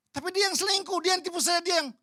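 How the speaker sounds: protesting. Retold or imitated. Menirukan orang protes.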